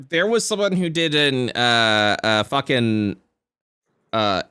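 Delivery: ordinary talk, nothing marked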